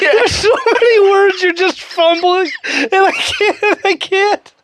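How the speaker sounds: high, slowed words through laughing; helpless laughter. Real, not acted. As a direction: unable to finish for laughing. Cracks up over the fumbling.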